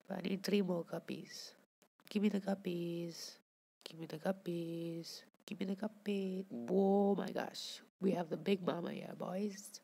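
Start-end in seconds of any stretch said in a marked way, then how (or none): none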